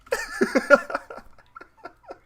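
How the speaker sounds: loud, high, sustained laugh; real high-pitched cackling fit; hysterical laughter. Real, not acted. Cracks up at the accent.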